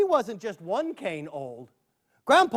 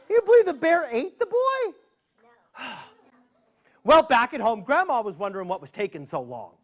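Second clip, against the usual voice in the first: ordinary delivery, nothing marked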